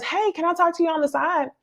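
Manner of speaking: high, soft polite ask; lightly voiced sugary request; sweet Ashley. Retold Ashley quote.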